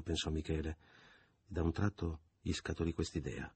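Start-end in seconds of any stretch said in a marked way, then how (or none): none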